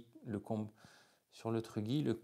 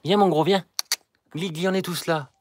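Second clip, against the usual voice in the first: loud, high call across the yard; 9 semitones higher, 19 dB louder than usual. Real, not acted. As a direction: call him in loudly. Calling the animal outdoors.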